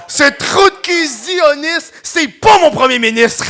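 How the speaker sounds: high, loud, slow mock yell; mock shout. Retelling what she shouted at the police, slow high mock yell. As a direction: bellow the insult, stretching each word.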